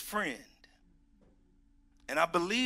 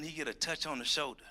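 ordinary talk

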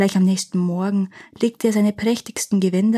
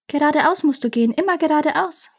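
higher-pitched bird giving directions; lightly voiced parrot; 7 semitones higher than usual. A narrator's reading. Parrot directs the prince onward, lightly voiced. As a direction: urge him onward in a raised voice.

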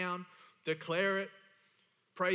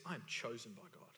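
low, soft, private affirmation; hushed declaration; 8 semitones lower, 11 dB quieter than usual. Acted. Preacher models believer's expectation, hushed. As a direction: drop low and quiet, as if to yourself.

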